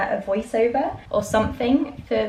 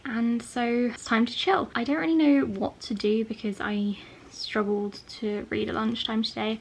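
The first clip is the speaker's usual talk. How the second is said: normal talking voice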